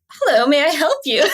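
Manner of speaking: a hint of a surprised host; polite homeowner. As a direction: greet the strangers politely but puzzled. Homeowner greets strangers at night.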